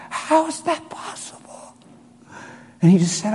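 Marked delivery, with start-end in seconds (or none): 0.1-1.7 high, slow, choked whisper; imitated son, overwhelmed and breaking; tearful disbelief. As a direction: squeak it out through tears. Retelling the overwhelmed, crying son.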